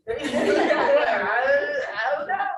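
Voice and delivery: high, loud laughing protest; laughing helplessly. Retold or imitated. Student answering an awkward Trump question, laughing helplessly. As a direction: burst out laughing through the words.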